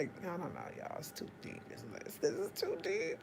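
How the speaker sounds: whispered, trailing off; whispering. Real, not acted. Backing away from a heavy topic, whispered. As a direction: breathe it out under the voice.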